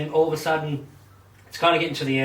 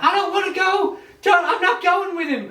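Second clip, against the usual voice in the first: much higher, loud mock whine; protesting daughter; 4 dB louder than usual. Daughter refusing her father, high mock whine. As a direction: whine like a stubborn young woman.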